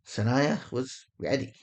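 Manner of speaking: ordinary talk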